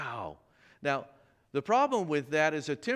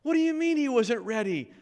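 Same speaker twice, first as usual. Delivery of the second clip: incredulous; 10 semitones higher, 6 dB louder than usual. Retold or imitated. Colleague hears surprising news.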